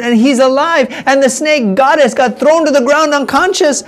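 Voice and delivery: triumphant. Acted. Old lady triumphantly corrects villagers.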